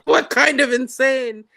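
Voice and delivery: parroting his disgusted question; imitating friend. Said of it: "He's like".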